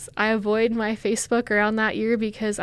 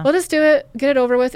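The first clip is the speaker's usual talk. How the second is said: louder, pushing herself through it; forced resolve, pushed out loud; 5 semitones higher, 9 dB louder, faster than usual. Retold or imitated. Woman retelling her inner voice, loud self-urging. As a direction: talk yourself into it, loud and quick. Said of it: "I'm like".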